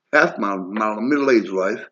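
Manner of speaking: ordinary talk, nothing marked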